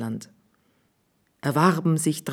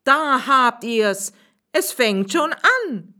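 much higher, louder, slow cross schoolmaster; annoyed shout; 8 semitones higher, 3 dB louder, slower than usual. Acted. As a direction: snap back: told you so. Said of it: "rief er ärgerlich".

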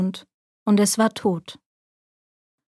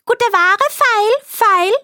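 hoch und laut rufende alte Krämerin; 12 semitones higher, 4 dB louder, slower than usual. A narrator's reading. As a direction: lock die Kundschaft mit hoher Stimme. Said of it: "klopfte an die Türe und rief". (verkleidete Königin ruft vor der Tür der Zwerge: hoch und laut)

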